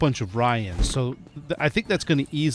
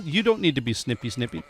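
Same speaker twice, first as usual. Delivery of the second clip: normal talking voice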